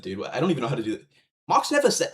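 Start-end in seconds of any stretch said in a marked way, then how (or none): 1.5-2.1 high, mock Bosnian accent quoting the YouTuber; a half-hearted Bosnian accent impression; failed Bosnian accent. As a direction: fake a Bosnian accent badly, pitched up. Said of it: "I can't do a Bosnian".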